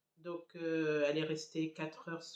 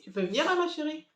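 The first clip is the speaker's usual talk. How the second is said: higher, louder sing-song coaxing; 5 semitones higher, 6 dB louder than usual. Real, not acted. Mother calling her sleepy daughter over, higher coaxing voice. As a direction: sweetly call the little one over.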